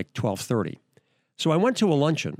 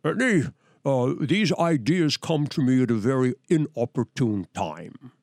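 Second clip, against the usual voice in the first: Hitchcock impression. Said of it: "he was talking like this".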